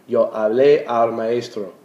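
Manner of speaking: Yo hablé al maestro, slow and clear, stress on the final é of hablé; careful classroom model sentence. Shown as a demonstration. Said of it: "accent that E".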